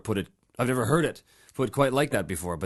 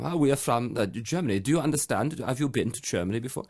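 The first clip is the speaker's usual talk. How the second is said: put-on German accent. Prankster answering girls at a bar, mock German accent. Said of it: "I begin to speak like Matthias".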